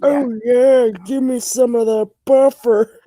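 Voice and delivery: playful, exaggerated mock swoon; mock fan voice. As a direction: play a hammed-up smitten train fan. Mock fan shipping trains.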